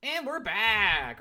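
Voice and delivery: loud, high, drawn-out show comeback; announcer-style return. Real, not acted. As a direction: stretch it out big to welcome listeners back. Host announcing return from break.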